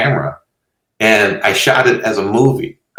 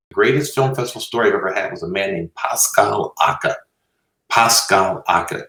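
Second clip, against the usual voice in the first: ordinary talk, nothing marked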